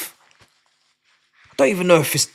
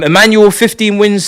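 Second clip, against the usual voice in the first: ordinary delivery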